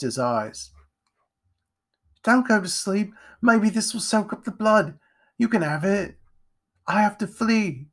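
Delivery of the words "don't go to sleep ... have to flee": high-pitched, worried urging; anxious urgency. A narrator's reading. Jack tends Edgar before fleeing, anxious. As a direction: urge him along anxiously, pitched high. Frightened boy tends wound, must flee.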